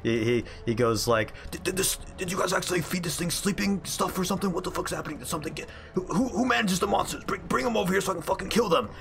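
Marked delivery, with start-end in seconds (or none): 1.5-8.9 high, fast, half-hushed warden rant; warden whisper-ranting. Acted. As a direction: rush it out high, as a warden trying to keep it down. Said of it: "everyone can hear him, like, whispering".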